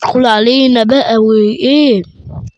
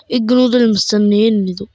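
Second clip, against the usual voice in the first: normal talking voice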